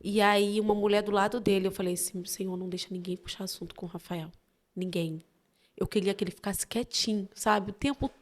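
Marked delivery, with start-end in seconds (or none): 2.2-5.2 low, soft prayer under the breath; hushed plea. Retold or imitated. Mother praying nobody talks to Rafael, hushed plea. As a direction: plead quietly, as if privately to God.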